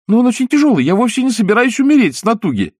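put-on high, whining puppet boy. A narrator's reading. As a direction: complain like a lazy boy. Pinocchio whining.

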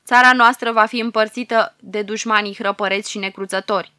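loud, doom-laden curse. Acted. Old sage curses the Pharaoh's land, loud and grim. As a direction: hurl it out like a curse. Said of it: "ca un blestem țâșnesc vorbele".

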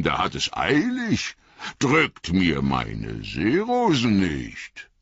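a merry, boisterous countryman talking; jolly old Tom. Acted. Tom Bombadil greeting the breathless hobbits, jolly booming voice.

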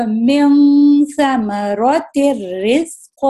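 من ثمرت الرزق slowly, ra doubled; complete merge recitation. Shown as a demonstration. Teacher repeats correct recitation for students, slow. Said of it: "نون کو بھلا دینا ہے".

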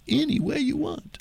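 high, slow, drawn-out mimicry; mocking quote. Host mimics what they won't say anymore, mocking singsong. Mocks the phrase once more.

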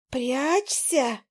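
ordinary delivery, nothing marked

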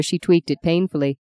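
usual speaking voice

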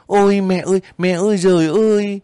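high, loud cry of sudden panic; panicked cry. A narrator's reading. Young girl cries out for her mother in panic, high and loud. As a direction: burst out wailing in alarm, face buried. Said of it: "cô gục mặt kêu hoảng hốt".

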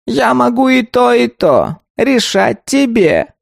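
high, slow, grumbling golem; a scolding creaky tree creature. A narrator's reading. Golem explains the options.

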